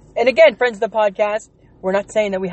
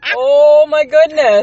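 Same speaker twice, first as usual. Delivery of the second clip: amused shock; 6 semitones higher, 6 dB louder, much slower than usual. Real, not acted. Reacting to twerk idea.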